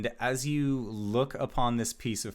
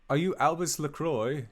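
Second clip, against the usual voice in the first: higher-pitched guardsman challenge; town guardsman; 8 semitones higher, 4 dB louder than usual. Acted. Guard stopping Albus at the tavern, official guardsman voice. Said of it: "he just says".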